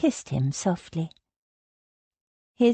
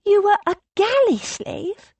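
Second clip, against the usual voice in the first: much higher, louder, aghast; horrified Rose; 13 semitones higher, 4 dB louder than usual. A narrator's reading. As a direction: cry it out in dismay.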